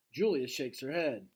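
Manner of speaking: ordinary delivery, nothing marked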